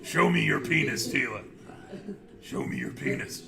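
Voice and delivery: low menacing villain whisper. Pretend. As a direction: hiss it like a creepy villain. Villain voice, lower.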